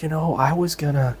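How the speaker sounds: softly voiced, hesitant new mother; lightly acted, quiet halting admission; imitated weary mom. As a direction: mimic her faltering, then draw out the last word. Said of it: "the other lady said".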